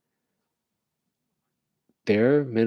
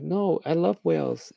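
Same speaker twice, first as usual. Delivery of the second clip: higher-pitched, drawn-up protest; mock earnest passerby; 7 semitones higher than usual. Passerby insisting on loving whales, mock earnest. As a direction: protest in a higher voice, as if put upon.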